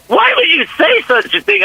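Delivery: mock-indignant wife asking why; imitating his wife. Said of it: "She goes".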